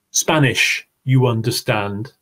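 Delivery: normal talking voice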